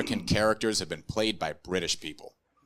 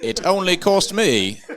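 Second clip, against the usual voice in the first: a lightly voiced Midwestern American kid playing Harry Potter; a cheerful American teenager, plain American vowels; American teen accent; 5 semitones higher, 3 dB louder than usual. American teen cast as Harry Potter introducing himself, lightly voiced American accent. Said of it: "American teenager playing Harry Potter".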